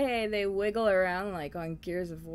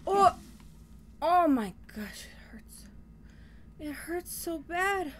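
slow, higher, pained; being chased; 9 semitones higher, slower than usual. Real, not acted. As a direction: groan out the hurt. Getting hurt in game.

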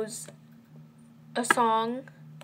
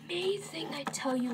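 whisper; 5 semitones higher, 6 dB quieter than usual. Acted. Whispered aside about editor.

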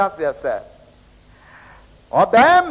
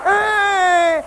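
crying shout; 9 semitones higher than usual. Acted. Preacher mocks a crying outcry.

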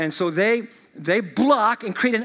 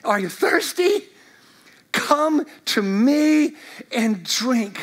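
loud call; 3 semitones higher, much slower than usual. Acted. Jesus calling to all Jerusalem, loud slow invitation. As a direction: ring it out, then slow and drawn on drink. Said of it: "In a loud voice".

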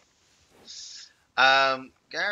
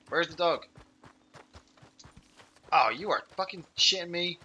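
normal talking voice

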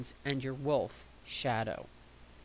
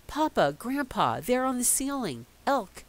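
put-on excited child; 8 semitones higher, 4 dB louder than usual. Child calls out to family.

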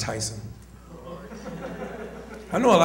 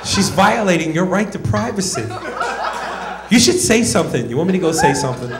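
lightly voiced outraged buddy, higher; 3 semitones higher than usual.

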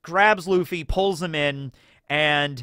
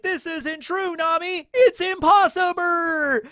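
very high, slow, pleading denial; slower than usual. Retold or imitated. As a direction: cry out that it can't be, drawn out. Luffy refusing to believe.

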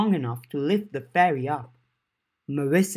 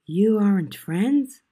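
surprised; 7 semitones higher, 5 dB louder, slower than usual. A narrator's reading. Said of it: "Avery asked".

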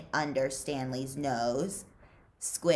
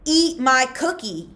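loud and higher, hard command; menacing demand; 9 semitones higher, 9 dB louder than usual. Acted. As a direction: force it on him. Zigzag pushing Stanley hard.

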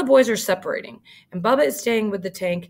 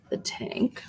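whisper; 8 dB quieter than usual. Shown as a demonstration. Teacher pausing on tank image.

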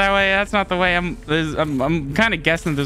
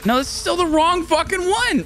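exasperated; 12 semitones higher than usual. Real, not acted. Exasperated at game.

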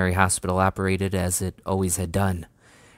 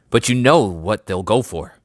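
louder, disgust leaking through; barely disguised disgust; 2 semitones higher, 7 dB louder than usual. A narrator's reading. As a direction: let the disgust show under the politeness. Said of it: "barely disguising the disgust in his voice".

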